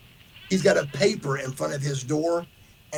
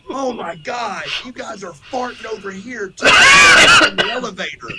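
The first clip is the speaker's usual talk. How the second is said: high, loud "oh my God"; exclaiming; 5 semitones higher, 10 dB louder than usual. Retold or imitated. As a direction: call out in disgust. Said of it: "he goes".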